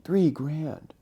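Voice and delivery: high, disbelieving echo; incredulous. Retold or imitated. Harris balks at the asking price, incredulous.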